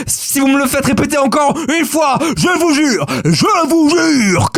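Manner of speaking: high shouted comic meltdown; hammed-up threat. Host threatening listeners for making him repeat, high mock-furious shout. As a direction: lose it, high and slowing on the last words.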